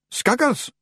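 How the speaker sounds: high-pitched Scots Feagle voice; small high Scottish character voice; Feagle leader. A narrator's reading. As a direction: pitch it right up like a wee Scotsman.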